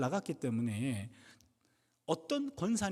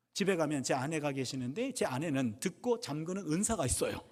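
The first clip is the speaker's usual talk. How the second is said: ordinary delivery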